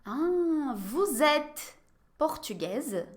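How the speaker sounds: "vous êtes portugaise?" very slow, word by word; slow model question. Shown as a demonstration. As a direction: drag the question out for learners. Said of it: "Je vais vous poser quelques questions". (pergunta bem devagar)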